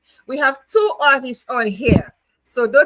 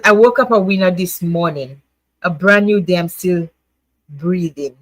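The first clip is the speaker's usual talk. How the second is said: louder, read-aloud quote; 4 semitones lower, 4 dB louder than usual. Shown as a demonstration. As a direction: read the card like an affirmation. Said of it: "but it says".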